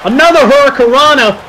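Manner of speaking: fast, rising play-by-play call; wrestling commentator. Acted. Mock commentary of a game match.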